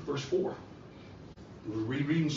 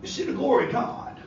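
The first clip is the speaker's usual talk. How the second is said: high, ringing preaching voice; fervent preaching; 6 semitones higher, 3 dB louder than usual. Acted. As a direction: preach the scene with fire. Preaches the raising of Lazarus.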